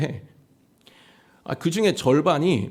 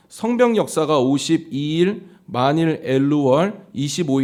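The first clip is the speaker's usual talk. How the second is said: scripture reading; 5 dB louder than usual. Acted. Reads scripture passage.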